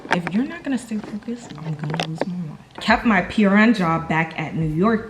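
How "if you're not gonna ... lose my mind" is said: a whispered telling-off to someone nearby; whispered scolding. Real, not acted. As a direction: hiss the warning under your breath.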